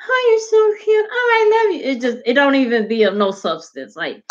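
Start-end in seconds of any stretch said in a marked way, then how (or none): 0.1-1.9 high, louder gushing fan voice; mock gushing fans. Imitating fans commenting on a cute guy, high and gushing. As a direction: gush like a smitten follower. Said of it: "they just like".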